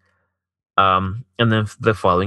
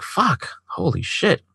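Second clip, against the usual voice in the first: high, drawn-out stunned curse; stunned disbelief; 11 semitones higher, much slower than usual. Retold or imitated. Man recalling his reaction to her confession, stunned. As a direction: let each word stretch upward in disbelief.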